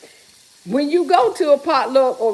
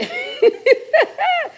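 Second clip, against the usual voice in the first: sudden high giggle; high laugh. Real, not acted. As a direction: laugh at the joke. Laughs at chat.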